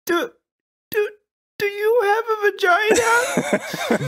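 hesitant, stammering, high and slow; sheepish. Shown as a demonstration. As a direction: shyly stumble over the question. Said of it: "it has to be a bit sheepish".

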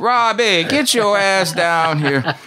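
loud, slow, high Louisiana drawl calling for Robbie; Louisiana twang. Retold or imitated. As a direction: General hollering for his son in a Louisiana twang. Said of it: "so he had that Louisiana twang in the speech".

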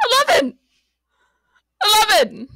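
exasperated repeat; frustrated retry. Acted. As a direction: force it out in frustration. Frustrated retries at the lift.

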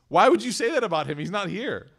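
high, loud, incredulous retort; indignant pushback. Retold or imitated. Niece challenges her aunt for cursing her son, indignant. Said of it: "She pushes back".

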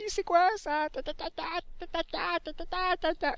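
very high, squealed mock outrage; mocking English viewers. Imagining English viewers' reaction to the accent, high-pitched mockery. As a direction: imitate complainers in a squeaky falsetto jabber. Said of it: "tous les Anglais qui me regardent".

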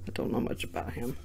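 whispered under the breath; whispered aside. Real, not acted. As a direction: drop to a whisper. Quiet aside while checking cards.

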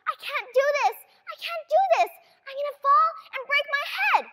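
put-on high, desperate child's voice; a scared kid repeating her fear; panicked little girl. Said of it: "I keep reminding myself".

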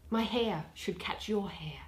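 normal talking voice

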